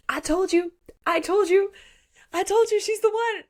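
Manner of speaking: high, loud gleeful crowing; acted triumphant squeal; excited I-told-you-so. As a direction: crow it way up high. Cal crows to Axel about her.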